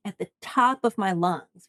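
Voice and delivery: ordinary talk, nothing marked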